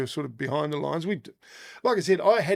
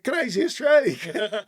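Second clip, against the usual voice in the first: slower, higher call of Crazy Australia; checkpoint greeting; 5 semitones higher, slower than usual. Retold or imitated. Guards at the checkpoint greeting him, a slow higher hail. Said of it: "they'd say".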